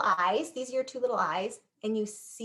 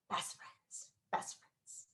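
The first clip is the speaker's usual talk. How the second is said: whispered; 14 dB quieter, much slower than usual. Shown as a demonstration. Shows crossed fingers sign.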